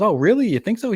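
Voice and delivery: high, hopeful imitation; pleased boyfriend. Boyfriend asking her about his story, high and mock-hopeful. Boyfriend fishing for approval, retold.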